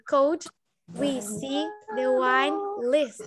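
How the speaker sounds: Could e pausa, resto bem lento; separated slow. Shown as a demonstration. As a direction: separado e arrastado. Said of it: "Juntando tudo fica". (separado devagar)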